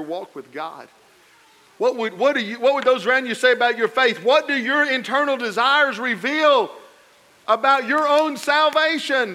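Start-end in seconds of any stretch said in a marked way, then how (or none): 1.8-9.3 loud, high questioning cadence; full-force preaching. Acted. Pastor challenging the congregation, loud preaching. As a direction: press them with each question.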